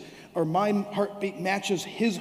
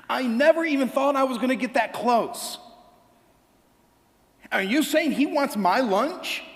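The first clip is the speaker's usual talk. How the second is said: put-on higher, amazed kid voice; excited little boy; 4 semitones higher than usual. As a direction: gush like a starstruck child. Voicing the boy amazed at Jesus.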